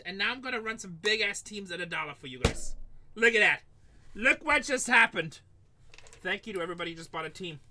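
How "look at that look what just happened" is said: excited. Real, not acted. Breaker thrilled at buyers, loud high excitement. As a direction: marvel at the sales out loud. Teams selling fast on stream.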